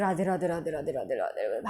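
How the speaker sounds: very low, hushed rapid repetition; disgusted muttering. Shown as a demonstration. Teacher imitating a disgusted greeting, muttered low. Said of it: "अगर मैंने कुछ वैसी वाली चीज़ें".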